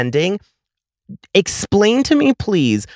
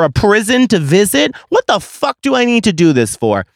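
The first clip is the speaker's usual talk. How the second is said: furious rant; 6 semitones higher than usual. Real, not acted. To Kendo over the curbside order, furious ranting. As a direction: vent like you've been pushed too far.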